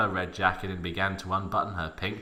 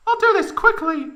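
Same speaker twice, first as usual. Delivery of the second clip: mock whisper in falsetto; 7 dB louder than usual. A narrator's reading. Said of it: "whispered Tifa".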